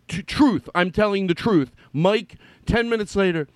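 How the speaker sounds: usual speaking voice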